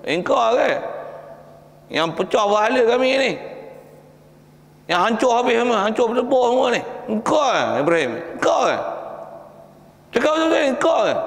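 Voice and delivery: high, pressing accusation; accusing interrogation. Acted. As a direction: demand the truth from Ibrahim. Villagers interrogating Ibrahim. (soal siasat dengan nada tinggi menuduh)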